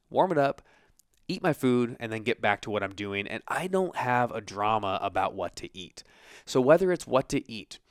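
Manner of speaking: ordinary talk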